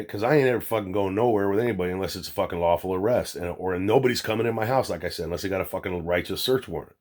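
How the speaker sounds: ordinary delivery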